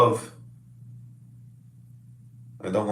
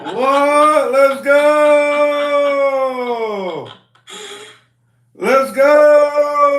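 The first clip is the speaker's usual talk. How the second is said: high, loud yell of joy; excited cheering; 6 dB louder, much slower than usual. Real, not acted. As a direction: shout it out like a winning goal. Cheering the pregnancy news.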